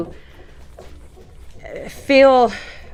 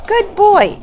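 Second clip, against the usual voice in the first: high praise; 12 semitones higher, 7 dB louder than usual. Real, not acted. Groomer to dog after the nail, high loud praise. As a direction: praise him brightly and loud.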